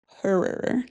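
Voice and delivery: quiet, drawn-out Midwest horror; Midwest accent. Shown as a demonstration. Host retrying the word in a soft Midwest accent. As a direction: let the Midwest r hang on horror. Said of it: "Try to say that with this Midwest accent".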